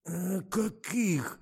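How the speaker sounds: slow, high, hesitant stammer. A narrator's reading. Driver asks investigator which questions, stammering. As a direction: falter and drag out the question. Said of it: "прозаикался водитель".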